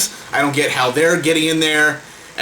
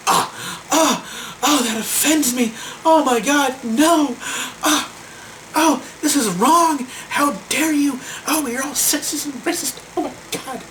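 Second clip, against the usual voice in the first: high, put-on voice of fake outrage; mocking offended people; 9 semitones higher than usual. Mocking the offended crowd, high put-on outraged voice. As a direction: parody the easily offended, pitched way up. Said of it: "These people are going".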